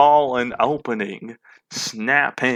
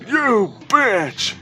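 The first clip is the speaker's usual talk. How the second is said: higher, sharp angry yell; shouting; 10 semitones higher than usual. Acted. The co-star shouting at Mavis after the bite.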